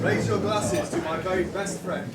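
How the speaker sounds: big toast to the couple; wedding toast speech. Acted. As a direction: raise a glass loudly, slowly. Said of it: "please be upstanding".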